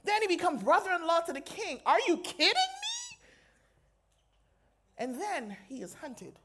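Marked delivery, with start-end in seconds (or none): 1.8-3.2 soaring high squeal of disbelief; amazed disbelief. Real, not acted. Preacher marveling to the crowd at David's rise, high amazed squeal. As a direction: shriek it like you can't believe it.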